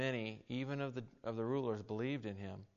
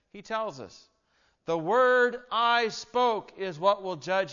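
preaching scripture; 6 semitones higher, 9 dB louder than usual. Acted. Pastor reading Jesus crying out to the crowd, in a loud preaching voice. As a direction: proclaim the words as if crying out to the crowd.

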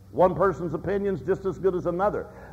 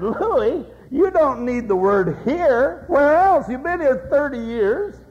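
higher, louder, slowly spelling out the obvious; incredulous needling; 7 semitones higher, 4 dB louder, slower than usual. Retold or imitated. Neighbour needling Louis about his sign, high and incredulous. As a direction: tease him for stating the obvious. Another passerby challenges Louis.

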